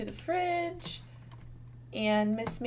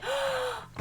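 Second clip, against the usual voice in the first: high, sharp gasp of delight; thrilled little gasp; excited gasp; 13 semitones higher than usual. Real, not acted. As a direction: gasp in happy shock. Sim is pregnant, thrilled.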